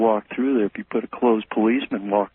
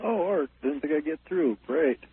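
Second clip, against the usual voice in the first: phone held away; 7 semitones higher, 5 dB quieter than usual. Real, not acted. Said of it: "I can barely hear you".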